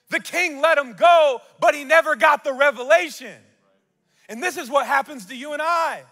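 high, loud climactic sermon; full-force preaching. Acted. Preacher declares the truth to his congregation, at full force. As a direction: drive the revelation home to the room.

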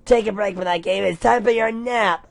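high, loud grandma call; from another room. Acted. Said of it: "Grandma yell from the other room".

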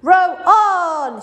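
high, loud, long-held call; urgent shouted command. A narrator's reading. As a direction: bellow the order to the rowers. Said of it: "shouted Mister Wonka".

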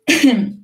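quick throat clearing. Real, not acted. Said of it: "I got something on my throat".

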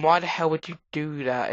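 usual speaking voice

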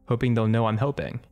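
ordinary delivery

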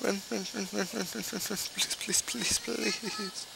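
high soft frantic pleading; being chased. Real, not acted. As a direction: beg under your breath. Fleeing, pleading softly.

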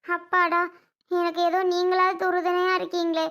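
ordinary delivery

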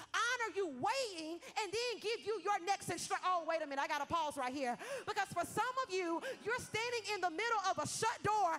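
loud, high preaching, stretched phrases; full-force preaching. Acted. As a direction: build it into a fiery peak over the music. Preaching climax over music.

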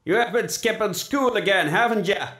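loud, high-pitched scolding; heavy, hectoring voice from memory; heavy familiar voice. A narrator's reading. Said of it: "asked a heavy, familiar voice".

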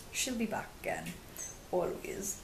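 a hushed, unvoiced aside; whisper. Real, not acted. Joking to viewers about her aunt, whispered. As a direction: whisper it so the aunt won't hear.

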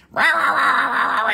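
mock ranting gibberish, starting high and falling; ranting husband. Seller mimics a husband's wordless nagging, mock rant. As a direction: imitate a husband yapping on and on. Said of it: "like a good husband does".

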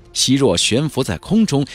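ordinary delivery

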